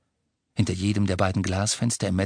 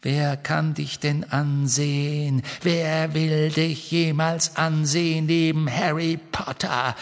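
taunting; 7 semitones higher than usual. A narrator's reading. Riddle-Hermine verhöhnt Ron.

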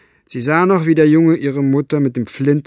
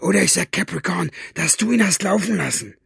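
high, urgent angry threat; furious villain pressing his demand; yelled threat; 8 semitones higher, faster than usual. A narrator's reading. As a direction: threaten him in a raised, heated voice. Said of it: "brüllte Basta".

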